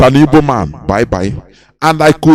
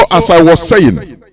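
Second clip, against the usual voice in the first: normal talking voice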